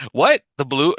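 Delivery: high, loud, puzzled "what?"; baffled reaction. Retold or imitated. Podcaster retelling his confusion over the Blues, baffled. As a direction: retell being completely thrown, voice jumping up. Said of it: "It took me a second to, like, figure out".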